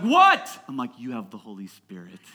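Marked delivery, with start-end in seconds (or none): 0.0-0.6 high, loud "what" going up; mock outraged yell. Teen reacting to pastor's laughter, mock outraged yell. Retold teen's shocked reply. 1.0-2.2 low, soft, understated answer; hushed deadpan reply. Retold or imitated. Pastor explaining to the teen, hushed deadpan reply. Calm reply after the shouting.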